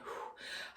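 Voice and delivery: soft, unvoiced blown-out breath; quiet steadying sigh; re-enacted anxious exhale. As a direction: blow the air out softly, as if anxiety kicks in. Acts out breathing through rising anxiety.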